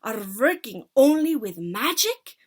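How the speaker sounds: loud witch shout. Acted. Witch leader shouting at the audience, loud. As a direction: shout down at them. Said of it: "she shouted at them".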